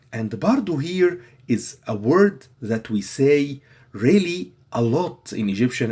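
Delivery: ordinary talk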